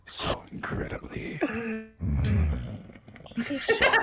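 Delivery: hammed-up sob voice; mock crying. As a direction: fake teary apology. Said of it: "there goes the waterworks".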